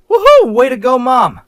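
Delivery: high, loud whoop; loud cheer. Acted. Husband cheering his mom on, loud gleeful whoop.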